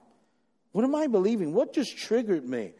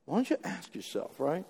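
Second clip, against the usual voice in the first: normal talking voice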